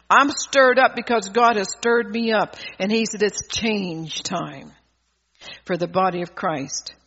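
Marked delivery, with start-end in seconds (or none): 3.2-6.9 very slow, lower, solemn pronouncement; slow it right down like a divine decree; voice of God. Acted. God's words quoted in sermon.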